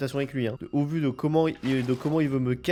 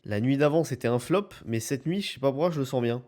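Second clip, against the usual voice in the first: normal talking voice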